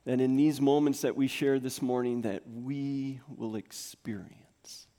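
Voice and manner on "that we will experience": slow and whispered. Real, not acted. Pastor sharing hope with church, whispered. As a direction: breathe it out softly.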